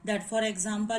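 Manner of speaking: ordinary delivery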